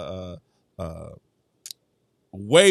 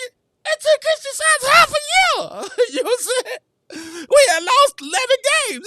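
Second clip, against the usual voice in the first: much higher, incredulous squeal; squeaky mock outrage. Vlogger reacting to the broadcast with a high incredulous squeal. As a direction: squeal it in disbelief. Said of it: "I was like".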